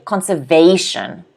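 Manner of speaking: "conservation" with VA stressed; stress on third syllable. Shown as a demonstration. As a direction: lean on con-ser-VA-tion in "conservation". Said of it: "the stress is on the they".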